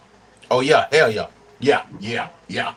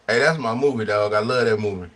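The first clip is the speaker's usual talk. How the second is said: usual speaking voice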